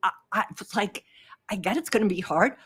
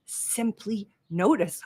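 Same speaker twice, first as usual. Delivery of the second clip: slow calm mantra; slower than usual. Shown as a demonstration. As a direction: let it land like a mantra, slow. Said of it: "what Rick says in his book".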